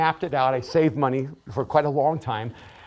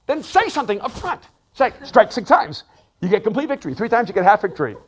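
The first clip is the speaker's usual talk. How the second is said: much higher and louder, exasperated; exasperated demand; 8 semitones higher, 9 dB louder than usual. Retold or imitated. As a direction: throw it up at God, fed up. Frustrated complaint at God.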